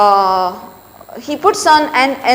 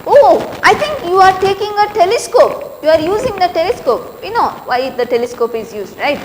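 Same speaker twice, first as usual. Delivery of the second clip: a lightly raised, mocking lady's voice; teasing Laura; 6 semitones higher than usual. Teacher voicing Laura, lightly mocking. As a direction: tease him about his huge glasses.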